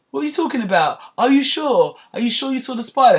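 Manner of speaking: high, doubting mock husband voice; mild gaslighting. Husband questioning his wife's spider sighting, mock doubtful. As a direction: make her doubt what she saw. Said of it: "he's doing the mild gaslighting now".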